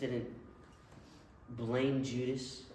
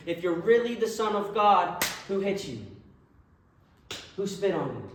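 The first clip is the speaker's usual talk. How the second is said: higher, loud taunting cry; jeering mock shout; 4 semitones higher, 6 dB louder than usual. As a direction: yell it like a cruel jeering soldier. Soldiers mocking the beaten Jesus.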